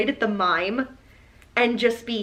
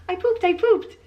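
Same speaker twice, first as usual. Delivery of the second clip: put-on proud kid voice; 7 semitones higher, 3 dB louder than usual. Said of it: "he's like".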